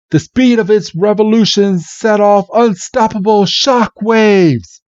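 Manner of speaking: high, projected arena announcer; hammed-up dramatic commentary. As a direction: call the battle over the crowd. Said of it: "the announcer's like".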